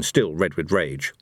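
ordinary delivery, nothing marked

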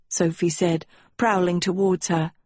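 ordinary delivery

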